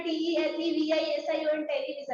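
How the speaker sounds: unbroken memorised recital, dropping away on television; rote spelling. Shown as a demonstration. Teacher showing pupils how not to rote-learn, mock rote recital. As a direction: run टी ई एल ई वी आई एस आई ओ एन together without breaks. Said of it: "ऐसा रटना नहीं है।".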